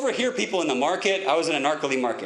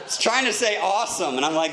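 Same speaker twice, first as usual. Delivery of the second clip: normal talking voice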